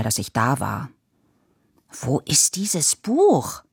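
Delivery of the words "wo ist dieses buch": agitated call. A narrator's reading. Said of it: "rief Papa aufgebracht".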